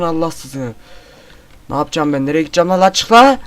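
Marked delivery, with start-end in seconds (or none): 1.7-3.4 panicked shouting while fleeing; frantic, high and loud; being chased. Real, not acted. Chased by monster in game.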